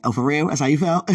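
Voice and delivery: loud, playful put-on challenge; mock indignation. Teasing sweetheart over old prom pictures, mock indignant. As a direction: pretend to be offended, about to laugh.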